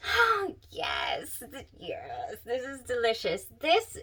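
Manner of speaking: ordinary talk, nothing marked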